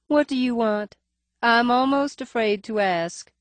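high nasal voice, faint lisp. A narrator's reading. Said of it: "Her voice is higher than one would imagine ... nasal cast ... faintest suggestion of a lisp".

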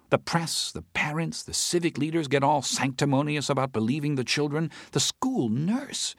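a worked-up friend ranting about the case; Teresa's agitated voice. Acted. Teresa rants to Howard about the judge, agitated.

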